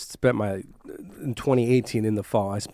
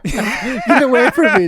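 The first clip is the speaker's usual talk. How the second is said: slow, high, loud laughing protest; 11 semitones higher, 6 dB louder, much slower than usual. Real, not acted. Laughing at his friend's threat, a slow high protest. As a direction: push him off while cracking up.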